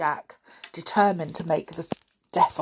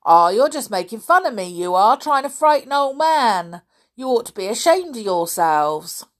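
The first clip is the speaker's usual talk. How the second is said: put-on loud, indignant old man; cross old caretaker; 5 semitones higher, 7 dB louder than usual. A narrator's reading. Caretaker accusing boys of mocking him, indignant. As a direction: scold loudly as an old man.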